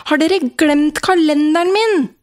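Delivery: put-on child shouting for mum and dad; loud child's call. A narrator's reading. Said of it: "roper jeg høyt".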